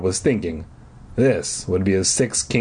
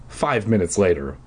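ordinary delivery

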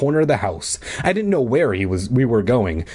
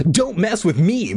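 high protesting voice; pleading cry; 8 semitones higher than usual. A narrator's reading. Bully backing away from the boy, pleading cry. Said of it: "Marv cried".

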